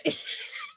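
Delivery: squeaky laugh. Real, not acted. Laughing at her own insult.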